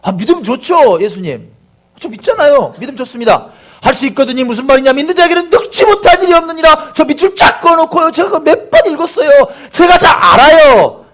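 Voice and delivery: high, loud, eager insistence of faith; confident believer. Acted. Preacher voicing a believer boasting to Jesus, overconfident. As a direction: rattle off your credentials, building to a shout. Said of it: "어떤 마음이냐, 이런 거예요".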